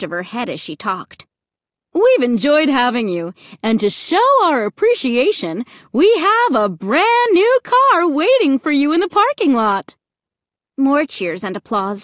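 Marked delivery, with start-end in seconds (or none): 1.9-10.0 high, loud prize announcement; cheery MC. A narrator's reading. MC presenting the family with a new car, cheery. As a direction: present the prize like a game-show host.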